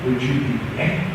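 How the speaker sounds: ordinary delivery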